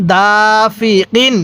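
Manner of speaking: slow, syllable by syllable হাসিদিন; slow recitation. Shown as a demonstration. Teacher reading the word slowly for students to copy. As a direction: draw each syllable out carefully. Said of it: "কিভাবে পড়ব?".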